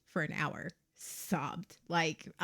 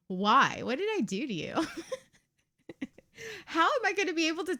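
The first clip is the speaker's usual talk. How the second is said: mock wounded plea; 7 semitones higher, 4 dB louder than usual. Mock hurt at a viewer.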